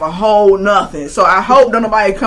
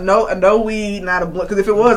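ordinary delivery

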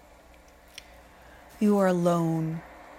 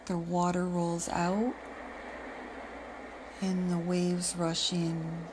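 the wave line again, softer and fading; softer echo; 5 dB quieter than usual. Acted. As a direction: let it fade like a retreating wave. Repeated wave phrase, echoed softer.

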